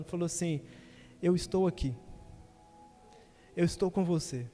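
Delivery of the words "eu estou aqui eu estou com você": God's voice. Retold or imitated. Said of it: "ouvi uma voz de Deus falando".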